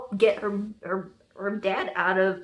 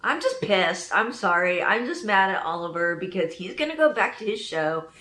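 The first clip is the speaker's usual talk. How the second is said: ordinary delivery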